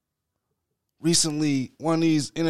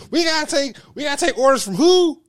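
mock outrage, much higher and louder; outraged Proud Boy; 14 semitones higher, 4 dB louder than usual. Mocking Proud Boys' outrage at taking orders, hammed-up indignant. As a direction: ham up an indignant militia guy. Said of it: "Could you imagine the Proud Boys being like".

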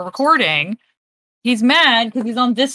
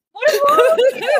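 laughing. Real, not acted. Laughing at the cat's failed climb.

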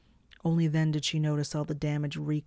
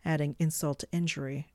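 plain reading voice

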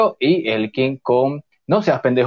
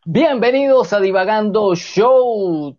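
announcer; 7 semitones higher than usual. Acted. Host echoes jingle welcome.

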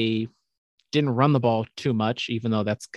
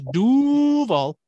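high, drawn-out team chant; sustained high call, stretched on the first vowel; fan rallying cry; 11 semitones higher, 4 dB louder than usual. Acted. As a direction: holler it long like a stadium crowd. Calls out the Jaguars fan chant.

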